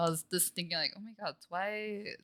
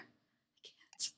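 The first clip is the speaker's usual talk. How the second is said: whisper; 19 dB quieter than usual. Acted. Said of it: "breaking down whisper".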